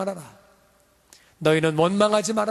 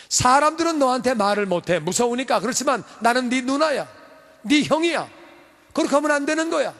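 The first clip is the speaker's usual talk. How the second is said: put-on scolding older sister; 6 semitones higher than usual. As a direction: rebuke him like a bossy big sister. Miriam scolding Moses in retelling.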